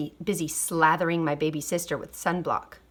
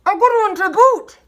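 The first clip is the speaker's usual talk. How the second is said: much higher, louder echoing crew voice; gleeful pirate echo; pirate crew chorus; 13 semitones higher, 8 dB louder than usual. A narrator's reading. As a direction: chime in as the rowdy crew. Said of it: "the others agreed".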